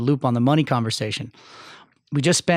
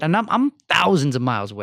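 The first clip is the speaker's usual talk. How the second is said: normal talking voice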